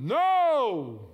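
a loud, high group shout; team yelling no. Retold or imitated. Players answering their coach, yelling. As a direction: bellow it back as the whole team. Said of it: "The team responded".

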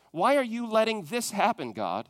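usual speaking voice

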